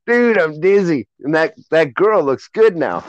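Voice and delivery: put-on high, loud goofy drunk voice; tipsy young guy. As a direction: play a dizzy first-time drinker. Acting drunk young boy.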